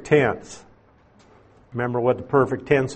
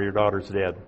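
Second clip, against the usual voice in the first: blunt, final; 6 semitones lower, 7 dB quieter, faster than usual. Retold or imitated. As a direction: lower and softer, a flat dead end. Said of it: "is that blunt or what?".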